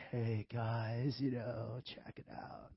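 soft, laid-back youth pastor; a hint of a mellow college pastor; casual preacher. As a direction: breeze it like a chill youth preacher. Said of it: "I should be preaching like".

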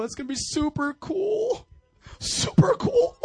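squeaky, laughing super cool; silly high voice. Pretend. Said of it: "I'm trying different things up here".

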